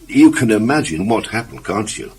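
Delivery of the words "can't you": plain reading voice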